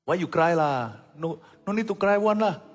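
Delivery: lightly Singlish-lilted question; a hint of a Singaporean accent; Singaporean English. Mocking someone crying, lightly in Singaporean English. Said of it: "Orang Singapura ngomong begitu".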